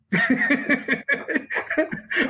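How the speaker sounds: high-pitched run of chuckles; long, high, bubbling laugh. Real, not acted. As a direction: giggle at your own impression. Laughs after quoting his dad.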